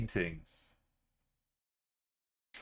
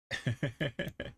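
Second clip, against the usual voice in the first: quick chuckle in a run of short bursts; a brief real chuckle; short laugh; 3 semitones higher than usual. Real, not acted. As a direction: let out a short rippling laugh. Laughs at the game.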